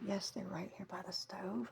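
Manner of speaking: hushed aside; whispering. Real, not acted. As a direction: drop to a whisper.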